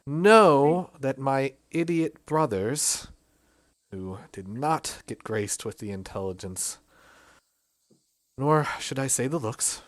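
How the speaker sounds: gentle apology. Acted. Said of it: "She looks down and says".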